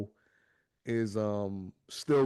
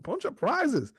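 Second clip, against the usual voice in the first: much higher, squealing impressed; mock impressed wife. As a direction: squeak it like a wowed mom. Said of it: "she's like".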